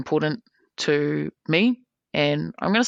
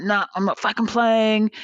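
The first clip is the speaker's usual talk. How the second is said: higher, petulant refusal; mock angry quit; 6 semitones higher than usual. Angry player quitting the table, higher petulant mock rage. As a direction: whine it like a sore loser. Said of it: "He's like".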